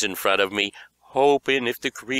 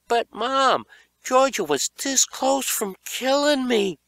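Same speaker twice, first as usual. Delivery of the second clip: whiny protest; 4 dB louder than usual. Acted. Boy pleading to mother.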